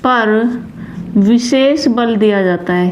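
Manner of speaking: slow, word-by-word dictation; halting writing-along pace; dictation while writing. Real, not acted. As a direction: say it in small pieces as you write, with long gaps.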